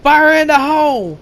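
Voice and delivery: high, loud warning yell; battle shout. Retold or imitated. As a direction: bellow the warning across the field. Soldier warns of incoming fireball.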